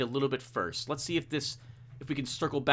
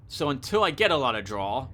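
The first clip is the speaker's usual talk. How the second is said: ordinary delivery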